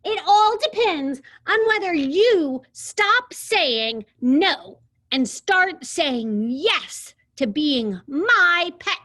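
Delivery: high, loud, slow bossy demand. Acted. A bossy girl orders the dinosaur to be her pet, loud and slow. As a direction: lay down the law word by word.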